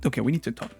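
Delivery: ordinary talk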